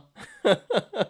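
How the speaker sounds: high, chuckling laugh; real laugh. Real, not acted. Streamer laughing at a stock's bad news, amused laugh. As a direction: laugh in short high bursts. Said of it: "I shouldn't be laughing".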